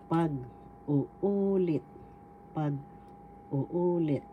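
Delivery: "Pag-uulit" very slowly, sounds drawn out; slow demonstration. Shown as a demonstration. Teacher reviews Tagalog for retell, slow demonstration. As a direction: stretch out "Pag-uulit" syllable by syllable for learners. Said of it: "Let's review".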